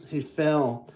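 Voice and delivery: usual speaking voice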